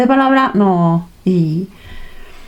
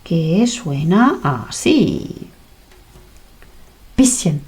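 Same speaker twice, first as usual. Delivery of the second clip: slow demo; 3 semitones lower, 4 dB quieter, much slower than usual. Shown as a demonstration. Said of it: "recordamos la C H".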